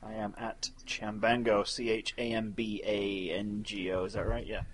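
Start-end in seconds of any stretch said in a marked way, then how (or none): 1.6-4.0 slow, letter by letter; soft, careful spelling of each letter. Shown as a demonstration. Spelling out Twitter handle.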